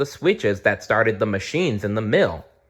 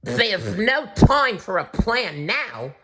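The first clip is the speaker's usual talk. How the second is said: slow, high, urgent creature voice; slow urgent little animal; Flap's voice; 11 semitones higher, slower than usual. A narrator's reading. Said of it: "said Flap".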